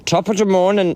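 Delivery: put-on Irish accent. Trying to do Welsh but landing on an Irish accent. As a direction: slip into a stock Irish greeting accent. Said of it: "No, that's Irish".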